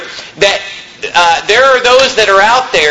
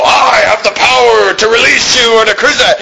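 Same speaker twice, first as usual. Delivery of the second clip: hammed-up blustering shout in a put-on voice; tough-guy Pilate; 4 semitones higher than usual. Preacher mocking Pilate's earlier bluster, hammed-up tough shout. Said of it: "He was so tough a minute ago".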